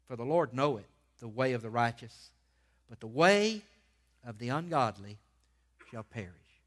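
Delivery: measured reading with long pauses and punched key words; emphatic scripture reading. Real, not acted. Preacher reading the first Psalm to his church, emphatic proclamation. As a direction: proclaim the verses phrase by phrase.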